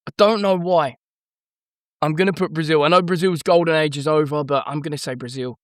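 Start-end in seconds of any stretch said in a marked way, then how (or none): none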